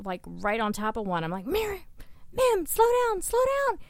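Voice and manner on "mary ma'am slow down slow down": very high-pitched urging, acted out; urgent retold plea. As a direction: plead with the driver in a high voice. Retelling urging the driver to slow.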